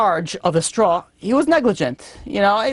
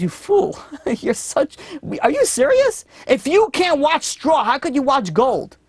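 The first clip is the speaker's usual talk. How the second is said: exasperated scolding king; 5 semitones higher than usual. Retold or imitated. King scolds the boastful guard.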